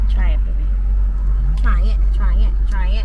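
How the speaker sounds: loud and pushy, try it; insistent urging. Real, not acted. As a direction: push him to taste it. Urging him to taste.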